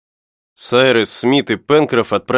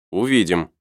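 brief, understated answer; curt reply; 3 semitones lower than usual. A narrator's reading. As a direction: answer briefly, without elaborating. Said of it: "кратко ответил инженер".

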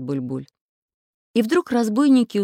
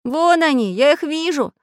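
high, loud cry of discovery; raised, lively yell; excited shout; 9 semitones higher, 5 dB louder than usual. A narrator's reading. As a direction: call out that you spotted them. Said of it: "закричал Прутик".

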